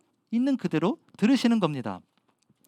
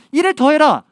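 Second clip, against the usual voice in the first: higher, louder scolding nag; nagging; 5 semitones higher, 6 dB louder than usual. Retold or imitated. Preacher mimicking a nagging God who demands more work. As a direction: nag like a parent pushing a child to study. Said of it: "이렇게 잔소리하지 않으세요".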